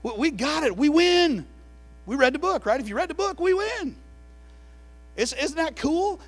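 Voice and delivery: high, loud triumphant preaching; preaching at full force. Acted. Preacher rallying the church that we win, full force. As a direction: shout the victory to the congregation.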